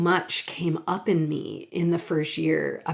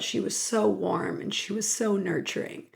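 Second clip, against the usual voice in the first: usual speaking voice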